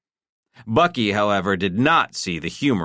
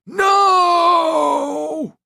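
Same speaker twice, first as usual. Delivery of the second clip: very high, loud horrified scream; 7 dB louder than usual. A narrator's reading. Husband screaming as his wife is shot. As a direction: scream as she falls. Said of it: "Clement screamed".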